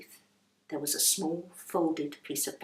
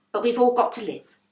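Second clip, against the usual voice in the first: louder, self-justifying landlady; a hint of a brusque excuse; defensive landlady; 4 semitones higher, 8 dB louder than usual. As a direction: practical landlady. Landlady takes coins.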